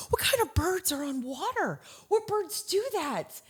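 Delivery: high-pitched, incredulous retold questions; acted bewilderment. Mother grabbing her family over birds on water, acting baffled. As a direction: re-enact pestering them in baffled disbelief. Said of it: "Literally, I am so confused".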